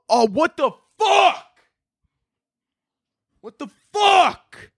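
high, drawn-out shocked cry; real alarm at his own toe; shocked outburst. Real, not acted. Vlogger seeing his nail fall off, shocked cry.

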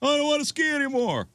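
put-on the boy refusing to ski again; whiny kid repeat. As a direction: whine it as the stubborn boy.

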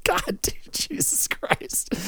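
helpless laughter, words barely voiced; real laughing fit. Real, not acted. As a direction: wheeze the words out through laughter. Cracking up at own bit.

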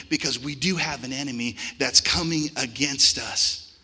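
normal talking voice

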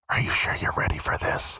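a soft voiceless whisper; creepy whisper. Retold or imitated. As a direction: breathe it into someone's ear. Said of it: "I heard her whisper".

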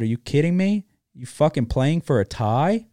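a half-acted furious yell; retold fury. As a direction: replay the outrage at the TV. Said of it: "I was furious".

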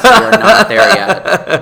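laughing loud and high. Real, not acted. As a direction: crack up while insisting.

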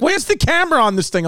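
high, fussy mom voice; a confused mom, voiced high; imitating mom. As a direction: pitch way up like a confused mother. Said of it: "She's like".

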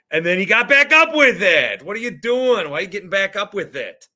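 loud, high-pitched disbelief at the play; exasperated. Real, not acted. Fan ranting at a player who got back up, exasperated. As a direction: demand to know what he was thinking.

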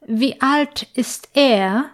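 usual speaking voice

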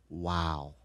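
low, very soft aside; quietly impressed, almost to himself; hushed awe. Retold or imitated. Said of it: "and I said".

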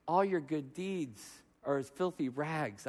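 low, soft, slow quotation; solemn divine answer. Retold or imitated. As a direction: answer quietly as God would. God's answer quoted from scripture.

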